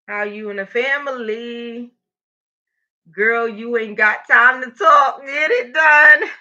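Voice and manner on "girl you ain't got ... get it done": sassy scolding. Pretend.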